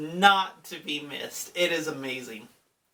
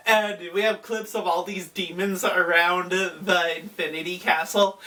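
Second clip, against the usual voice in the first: ordinary talk, nothing marked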